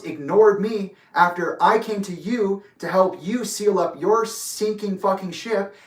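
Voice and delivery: real heated rebuke, hard emphasis; angry accusation. Real, not acted. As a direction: lay out the charges emphatically, voice up. Angry accusations at absent opponent.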